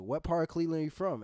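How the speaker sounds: lightly voiced DJ asking a caller; radio host. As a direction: put the question like an on-air host.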